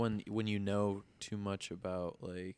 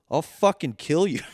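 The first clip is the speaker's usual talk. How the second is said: higher, louder mock threat; retold threat; 8 semitones higher, 11 dB louder than usual. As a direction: snap the threat back. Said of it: "and I'm claiming".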